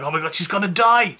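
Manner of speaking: high, melodramatic outburst of distress; hammed-up emotional alarm, pitch jumping up; mock choked up. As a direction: imitate an overwrought movie viewer. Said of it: "get really emotional and choked up".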